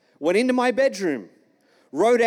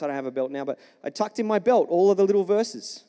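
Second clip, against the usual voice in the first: ordinary talk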